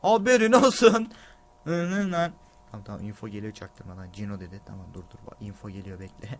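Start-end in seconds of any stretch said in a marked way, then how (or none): 2.7-6.3 hushed and much lower, sneaky. Real, not acted. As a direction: keep it down, secret tip. Sneaky info coming.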